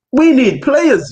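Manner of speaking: "we need players" drawn out slowly and high; emphatic insistence. Real, not acted. Fan pressing the panel about injuries, "we need players" slow and high. Pleading his point to the panel.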